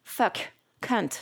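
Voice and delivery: English swear words in a French accent; each word a single clipped French-accented syllable. Shown as a demonstration. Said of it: "désolé pour l'accent".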